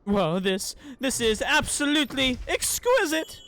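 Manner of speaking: loud delighted praise. Acted. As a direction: belt out the praise, drawn out. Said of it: "still don't really sound all that enthused".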